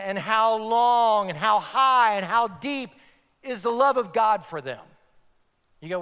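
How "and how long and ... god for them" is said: emphatic scripture reading. Acted.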